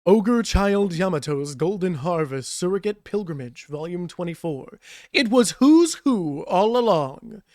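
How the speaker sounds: slow dramatic title read; narrator voice. Acted. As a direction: announce it like a trailer. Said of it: "I like doing my narrator voice".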